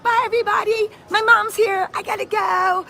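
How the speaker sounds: lightly voiced kid, high and chirpy; a hint of a kid whose mom just came; kid leaving school. Host compares it to the last day of school, high kid voice. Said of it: "It's like the last day of school. I'm like".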